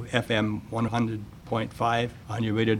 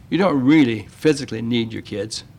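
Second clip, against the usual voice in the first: ordinary talk, nothing marked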